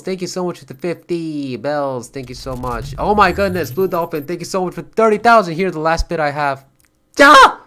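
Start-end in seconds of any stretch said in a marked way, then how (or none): 3.0-6.6 much higher and louder, overjoyed; thrilled thanks. Real, not acted. Streamer thanking Blue Dolphin for thirty thousand bits, thrilled. 7.2-7.6 very high, loud yelp of joy; excited squeal. Real, not acted. Streamer reacting to a huge donation, squealing.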